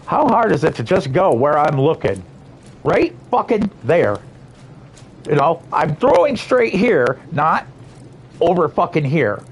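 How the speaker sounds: angry gamer losing patience; frustrated rant. Real, not acted. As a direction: vent at the controls, voice rising high. Raging at game controls.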